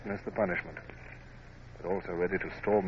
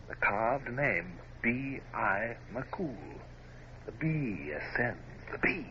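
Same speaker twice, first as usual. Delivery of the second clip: very slow, quiet, spelled out letter by letter; slow discovery; 3 semitones higher, 9 dB quieter, much slower than usual. Acted. Hornblower reading the name carved on the chest, slow and wondering. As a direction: puzzle it out word by word. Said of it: "spelling out the name slowly".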